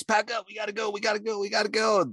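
high, urgent, rushed repeats; a scared guy telling the women to pack up, high and frantic; panicked hurry. Retold or imitated. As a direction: get everyone moving now. Panicked order to leave.

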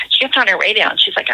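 ordinary talk

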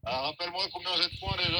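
phone call audio; on speakerphone. Real, not acted. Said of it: "vous êtes en haut-parleur".

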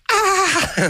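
shrill terrified shriek; high scream. Retold or imitated. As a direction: squeal in sudden fright. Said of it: "the Mark went".

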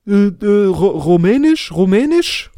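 mock drunk man stammering a question; drunk homeless man. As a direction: fumble the word like a drunk stranger. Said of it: "Dann sagt er so".

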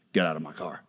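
very low, quiet deadpan; uber cool. Retold or imitated. Star to a fan shoved into his car, cool low deadpan. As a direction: drop it low and soft, totally unimpressed. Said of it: "he's just uber cool".

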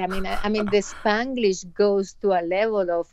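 normal talking voice

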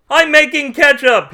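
very high, loud yell; high-pitched, full-volume shriek; manic scream. A narrator's reading. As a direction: scream it in crazed insistence. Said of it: "he screamed".

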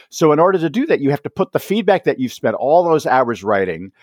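ordinary delivery

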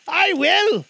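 put-on high loud nervous old man; frightened Scrooge. Scrooge answering the ghost, afraid. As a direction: blurt it out in fear.

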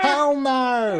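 high-pitched mob heckle; angry mob shout. Pretend. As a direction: yell it from the crowd. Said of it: "can't be expressed in a shout".

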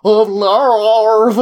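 high, loud stretched larv; [name] impression. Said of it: "the way that she's like".

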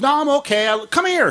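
high, quick mock mom voice; mother's voice. Son voicing his hurt mother, high.

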